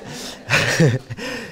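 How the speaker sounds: a low, soft chuckle; soft laugh. Real, not acted. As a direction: chuckle quietly at his own comeback. Laughs after his revenge line.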